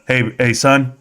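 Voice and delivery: lightly voiced old father, steady and sure; a hint of a wise dad at peace with fate; his calm father. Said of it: "He says, uh".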